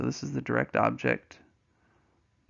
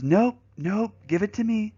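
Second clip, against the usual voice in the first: higher, insistent mock wife voice; imitated bossy wife; 8 semitones higher, 4 dB louder than usual. Wife demanding the ring from husband, insistent mock voice. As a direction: imitate a wife firmly refusing, pitched up.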